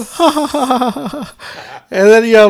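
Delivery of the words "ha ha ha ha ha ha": imitated laughing routine. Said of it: "Fred the Savage would do the whole".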